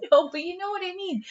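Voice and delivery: high laughing protest. Real, not acted. Host laughing at the VHS joke, voice pitched high.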